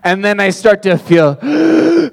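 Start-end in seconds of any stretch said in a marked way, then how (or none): 1.4-2.1 gasp. Retold or imitated. Gasping mid-run story.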